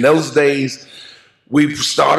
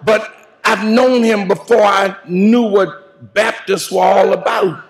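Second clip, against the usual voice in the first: full preaching; 5 semitones higher than usual. Acted. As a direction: preach it out at full force to the mourners. Eulogy preached to the congregation.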